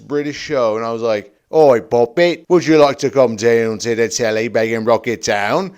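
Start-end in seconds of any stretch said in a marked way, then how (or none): 1.5-5.7 loud exaggerated Cockney; hammed-up Cockney patter; silly Cockney. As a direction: lay on a ridiculous British street accent. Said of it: "That's what I would be sounding like".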